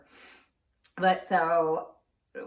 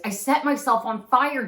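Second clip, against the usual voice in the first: I set myself on fire said louder and exasperated; aunt raising her voice when asked to say it louder; 5 semitones higher, 8 dB louder than usual. Retold or imitated. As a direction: repeat it loudly, annoyed at having to. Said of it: "You have to say that louder".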